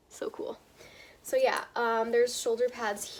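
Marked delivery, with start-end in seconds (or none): 0.1-0.6 whispered. Real, not acted. Admiring the shirt's detail.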